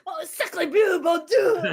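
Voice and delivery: high, loud mock French shriek; French scream. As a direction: shriek it like a panicked French cook. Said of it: "screams in French".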